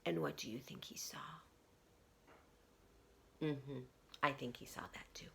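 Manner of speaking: quick conspiratorial whisper. A narrator's reading. Storyteller whispers a question to the children.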